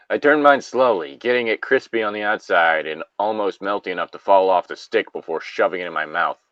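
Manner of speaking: ordinary talk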